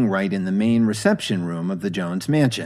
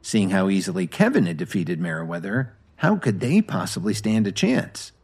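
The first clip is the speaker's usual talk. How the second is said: plain reading voice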